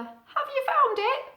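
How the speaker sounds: high, hopeful owlet question; high little owl voice. Acted. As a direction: ask the mouse hopefully. Owlet asks the frog.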